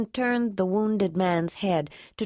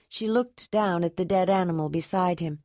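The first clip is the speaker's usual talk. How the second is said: plain reading voice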